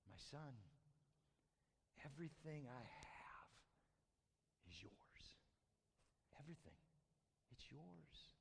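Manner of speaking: very soft, low, near-whispered fatherly reassurance; tender hush. Acted. Dad reassures his angry older son, tender hush. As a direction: speak it almost in a whisper with long pauses.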